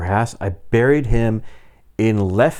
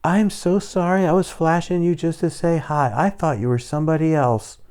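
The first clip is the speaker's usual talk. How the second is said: higher-pitched imitation of an apologizing woman; apologetic woman; 6 semitones higher than usual. Wife excusing herself to the cop, high apologetic imitation. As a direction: mimic her apology in a light, high voice. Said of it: "And she goes".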